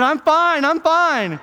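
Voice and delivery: high, loud frantic cry; panicked insistence. Acted. Old man facing the driver's shotgun, panicked shout. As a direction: blurt it out loudly to save your skin.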